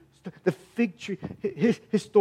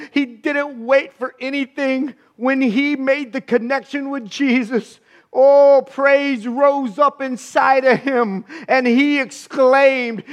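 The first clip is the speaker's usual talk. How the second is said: ordinary talk, nothing marked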